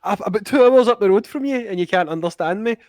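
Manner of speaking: high, exasperated mock retelling; exasperated quote. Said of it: "And they're like".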